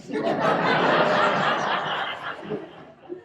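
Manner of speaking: mother cracking up at her son; loud high laugh. Acted.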